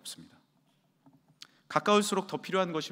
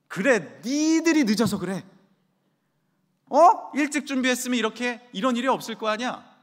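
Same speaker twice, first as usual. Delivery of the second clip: loud, higher, blaming shout; angry blame; 9 semitones higher, 10 dB louder than usual. Acted. Mother snapping back at her children, loud and blaming. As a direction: yell the blame onto the kids.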